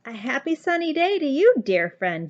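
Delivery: high, loud, fast happy call. A narrator's reading. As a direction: call out cheerfully across the way. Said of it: "Jubal called".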